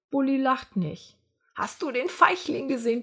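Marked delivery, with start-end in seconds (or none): 1.5-3.0 snorting with laughter. Acted. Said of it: "prustet Nick".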